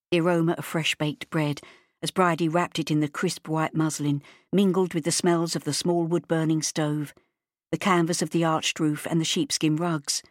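usual speaking voice

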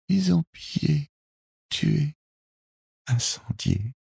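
put-on wounded girl panting out her story, very high; high voice in short, broken phrases; failing, breathless voice. A narrator's reading. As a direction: speak haltingly as your strength ebbs. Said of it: "d'une voix qui faiblissait à chaque instant".

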